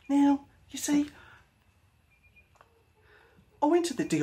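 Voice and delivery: high, swooping hammed-up impersonation; terrible impersonation. Host sending up Shane for a dad joke, terrible impersonation. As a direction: do a bad, high-pitched send-up of someone. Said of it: "a terrible impersonation".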